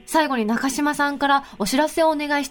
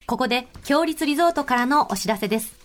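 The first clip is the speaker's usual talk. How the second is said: sponsor ad read; 3 semitones lower than usual. Acted. Host reading a resort notice to listeners, measured ad read. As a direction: read the resort spot in unhurried phrases.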